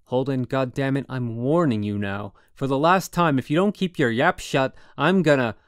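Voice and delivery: really mad. A narrator's reading. Roommate giving Holden a last warning, furious yell. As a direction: bark the final warning. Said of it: "That got him really mad".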